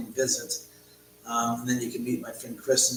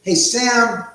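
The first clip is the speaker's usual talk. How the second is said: calling out; 11 semitones higher, 12 dB louder than usual. Real, not acted. Calling out to the cat.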